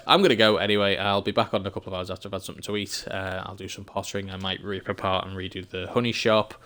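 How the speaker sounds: normal talking voice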